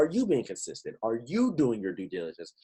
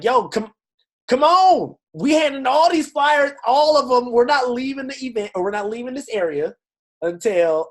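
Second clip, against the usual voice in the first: higher and louder, pumped-up street pitch; hyped flyer pitch; 11 semitones higher, 7 dB louder than usual. Retold or imitated. Fina hyping people at the event, loud and pumped. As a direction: shout it like you're rallying a crowd. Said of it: "she was out there like".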